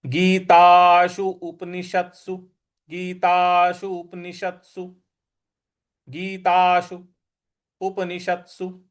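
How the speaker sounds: गीताशु उपनिषत्सु read slowly, short उ; careful recitation. Shown as a demonstration. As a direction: enunciate गीताशु उपनिषत्सु clearly. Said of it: "तो इसको इस प्रकार से पढ़ेंगे".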